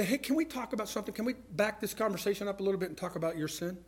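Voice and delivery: soft, quick, careful approach; hushed, hurried polite ask; tentative request. Acted. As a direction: broach a touchy subject quietly. Gently broaching a touchy subject.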